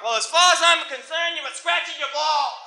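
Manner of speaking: loud, high, dismissive rant; hammed-up stubborn New York father; overruling dad. Stubborn dad dismissing his kid's excuse, loud mock scolding.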